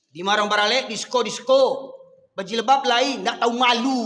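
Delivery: loud, punchy sermon rebuke; scolding. Real, not acted. Preacher scolds those dancing at weddings, scolding loudly. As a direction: raise the voice in indignation.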